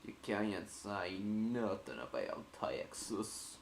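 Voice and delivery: understated, lingering Texan impression; Texan accent. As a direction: draw it out in a mock Texas accent. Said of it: "When you did that Texan accent".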